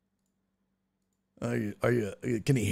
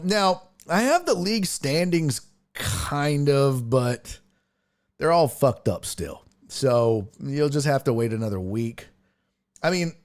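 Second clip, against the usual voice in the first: ordinary talk, nothing marked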